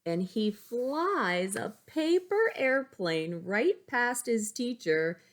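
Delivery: slow, lively read-aloud narration; storybook narration. Acted. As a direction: build the tension for the kids. Reads picture book aloud.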